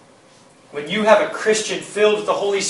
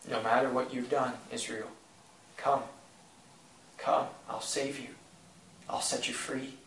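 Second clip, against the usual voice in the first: tender voice of God; 4 semitones lower, 7 dB quieter than usual. Retold or imitated. God tenderly calling Israel to come, softly. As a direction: lower and much softer, gently inviting. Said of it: "that was the voice of God to him".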